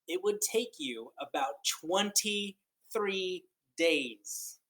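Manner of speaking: slow, lilting picture-book reading; read-aloud storytelling. Acted. Teacher reading a counting book to children, lilting and slow. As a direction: read it like a wondrous tale to kids.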